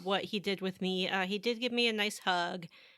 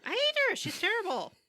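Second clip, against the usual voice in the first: emphatic outburst; 12 semitones higher than usual. Real, not acted. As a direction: burst out in mock outrage. Gamer ranting about disliked character.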